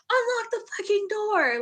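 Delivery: high-pitched imitation of a sergeant's yell; mock yelling at a superior; retold yell. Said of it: "he yelled at him so bad".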